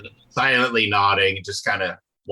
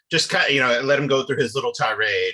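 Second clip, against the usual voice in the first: ordinary delivery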